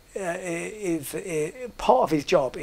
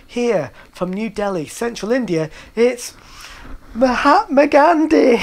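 compere announcement; 4 semitones higher, 6 dB louder than usual. Retold or imitated. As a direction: build to the big name like a stage host. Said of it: "here we go".

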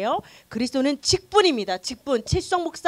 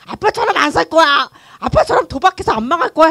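shouted, louder vow never to be like dad; defiant vow; 4 semitones higher, 11 dB louder than usual. Retold or imitated. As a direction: swear it loudly, full of resolve. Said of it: "했는데". (아빠처럼 안 산다고 크게 외치는 다짐)

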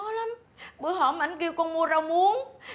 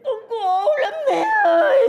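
louder wailing sob; crying out; 4 semitones higher, 7 dB louder than usual. Acted. Daughter-in-law wailing her suffering to her mother-in-law, sobbing loudly. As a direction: cry out your misery to her. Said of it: "mày đừng khóc mà".

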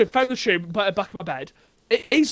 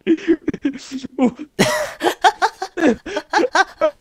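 loud laugh; 6 semitones higher, 4 dB louder than usual. Real, not acted. Reading the hidden-content message.

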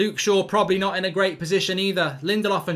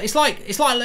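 normal talking voice

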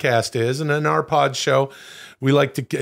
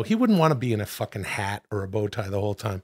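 ordinary delivery

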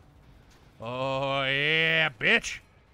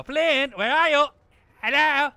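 calling out; 11 semitones higher, 9 dB louder, slower than usual. Real, not acted. Calling out for hidden enemy.

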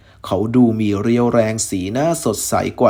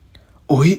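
surprised gasp; 4 semitones higher, 4 dB louder, much slower than usual. A narrator's reading. Sees the Sanada rope, surprised.

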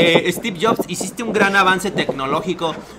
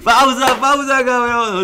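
loud, high call to stop; excited shouted interruption; urgent call; 6 semitones higher, 6 dB louder, slower than usual. Real, not acted. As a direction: cry out to halt the video, stretching the end. Calling out to stop the clip.

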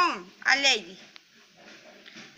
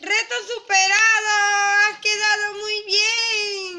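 higher, drawn-out cheer; excited praise; 5 semitones higher, 3 dB louder, slower than usual. Pretend. Mum cheering Lady's finished colouring, excited praise. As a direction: celebrate with stretched-out final words.

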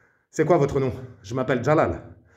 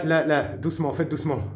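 ordinary delivery

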